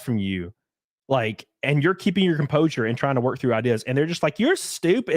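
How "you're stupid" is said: put-on high-pitched Valley-accent sneer; mock Valley whine, pitched up. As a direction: imitate a scoffing Valley accent. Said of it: "he has, like, a weird Valley accent".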